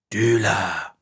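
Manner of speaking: drawn-out sinister hiss in the head; eerie, sibilant inner voice. A narrator's reading. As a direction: hiss it insidiously, stretching it out. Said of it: "la voix sifflante et lancinante".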